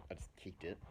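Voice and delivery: unvoiced whisper, an aside. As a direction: breathe it like a secret. Conspiratorial aside to audience.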